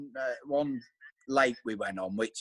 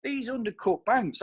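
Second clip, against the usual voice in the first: slow, higher, lightly voiced; imitating Baz; 9 semitones higher than usual. Angler retelling Baz's lesson, slow and higher. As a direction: spell it out like an old hand. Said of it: "he says".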